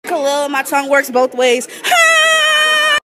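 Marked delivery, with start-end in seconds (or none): 1.9-3.0 high, loud, stretched "My"; mock intro. Girl opening her roast intro with a high, stretched "My". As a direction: launch the name with a squealing "My".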